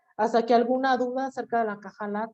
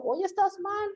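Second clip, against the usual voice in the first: higher, sharp little warning; scolding brain; 8 semitones higher, slower than usual. Retold or imitated. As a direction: catch the mistake and call it out. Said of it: "el cerebro te está diciendo".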